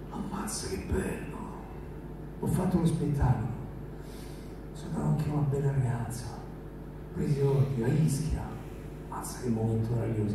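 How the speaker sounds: unvoiced blissful whisper. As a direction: whisper it, drifting off happy. Blissful thoughts drifting to sleep.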